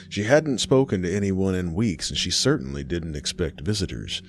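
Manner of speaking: usual speaking voice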